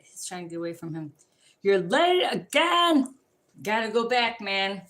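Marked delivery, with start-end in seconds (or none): 1.6-3.1 slow, high and loud, a hammed-up scolding; loud, drawn-out mock telling-off. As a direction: drag it out like an exasperated parent. Acted scolding quote.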